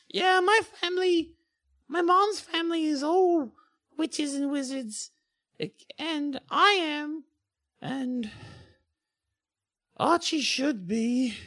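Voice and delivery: put-on high girl voice, faltering; hesitant schoolgirl. Schoolgirl explaining her brother should be magic, high and hesitant.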